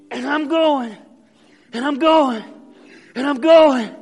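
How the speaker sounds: building strained grunt of effort; straining effort. Acted. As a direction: strain like lifting a heavy load. Preacher acts straining under weight.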